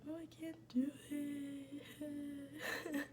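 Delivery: very slow, drawn-out mock sob; mock crying. Retelling pleading with her mom, slow mock cry. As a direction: whimper it like a crybaby. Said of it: "So I was like".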